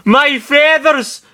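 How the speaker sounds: loud, high, drawn-out shriek; scream. A narrator's reading. Falco over burning feathers, high scream. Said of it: "Falco screamed".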